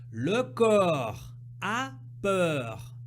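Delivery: corps a peur, very slow with the o and eu stretched; slow and exaggerated. Shown as a demonstration. Teacher modelling the O and E sounds, very slowly. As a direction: spell out corps and peur syllable by syllable. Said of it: "bien insister sur le E, le O".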